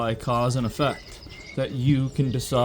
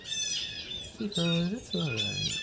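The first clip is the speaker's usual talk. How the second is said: very high, soft squeak; falsetto coo; 9 dB quieter, much slower than usual. Real, not acted. To the little birds, a tiny falsetto coo.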